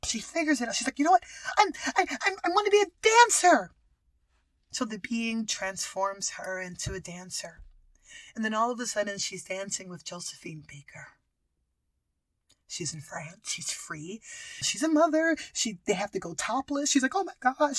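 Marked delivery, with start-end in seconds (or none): 1.0-3.7 high, loud, stammered declaration; acted exasperation building to a shout; frustrated outburst. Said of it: "She gets so frustrated". 17.1-17.8 mock shock. Acting dancer told to go topless.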